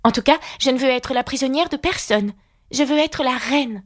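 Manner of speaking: a hint of young Alice, firm; light girl's voice wanting to be queen. A narrator's reading.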